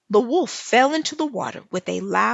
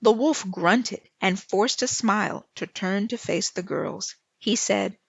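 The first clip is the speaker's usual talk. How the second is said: ordinary delivery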